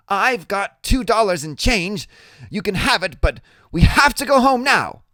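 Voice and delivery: put-on high, loud kid voice; pleading Freak. A narrator's reading. Freak offering Iggy his change, high and pleading.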